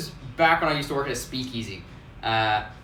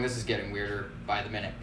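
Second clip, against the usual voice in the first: muttered aside; 5 semitones lower, 8 dB quieter, faster than usual. Retold or imitated. Bartenders muttering to each other.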